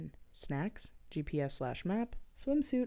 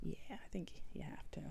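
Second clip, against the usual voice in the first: whispering; 10 dB quieter than usual. Real, not acted. Soft aside agreement.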